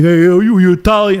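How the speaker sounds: a loud mock jeer of "Hey, yo"; mock mocking manager. Italian host mimics English manager's loud taunt, mock jeering. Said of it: "they might do something".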